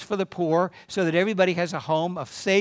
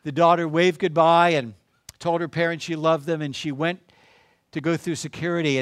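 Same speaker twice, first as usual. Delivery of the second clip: normal talking voice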